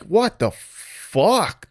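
drawn-out, baffled "what the fuck"; slow, incredulous quoted reaction; exasperated disbelief. Retold or imitated. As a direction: stretch it out in disbelief. Quoting exasperated reaction to performance.

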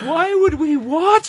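high, drawn-out comic wail; mock sobbing. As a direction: wail it in hammed-up despair. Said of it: "we were sobbing".

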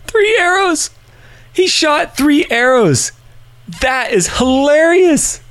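very high, drawn-out squeal of amusement; tickled by own joke. Real, not acted. Host relishing his own bow hunting joke, high tickled squeal. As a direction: squeal it slowly, far above normal pitch.

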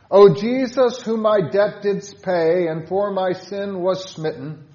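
loud and high, prayerful; fervent loud recitation of a hymn prayer; fervent hymn reading. Shown as a demonstration. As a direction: call out to Jesus from the heart. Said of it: "With stanza five".

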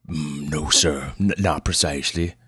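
put-on halting, gruff backwoods old patient; gruff old man. Old patient answers the doctor's question, gruff and halting. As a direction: hesitate gruffly, like a grubby mountain man. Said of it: "Pleasanton's voice was as gruff as his appearance".

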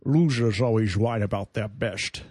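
Sean Connery impression. Shown as a demonstration. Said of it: "I do a Sean Connery impression".

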